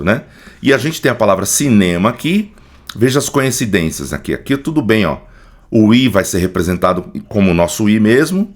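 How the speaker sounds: ordinary delivery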